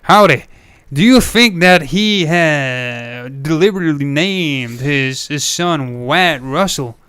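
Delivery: put-on slow, stretched-out cowboy American accent. Co-host asking about a Western namesake, slow put-on American accent. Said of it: "I'm just putting on this accent for a moment".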